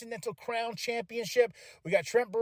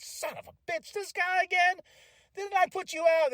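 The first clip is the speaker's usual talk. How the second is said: higher, incredulous mock outrage; exasperated Swerve; 11 semitones higher than usual. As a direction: whine it like he can't believe this guy's back. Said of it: "I can just see Swerve like".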